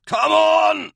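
loud, high rallying yell; crying out. A narrator's reading. Captain urging his troops forward, shouted cry. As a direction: yell to get everyone moving. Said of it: "Spetnin cried".

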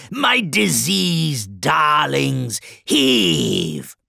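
loud, slow, drawn-out urging; shouted HEAVE, then gloating coaxing; exhorting. A narrator's reading. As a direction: egg on your workers, relishing each endearment. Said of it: "To the beat of Septicus's exhortations".

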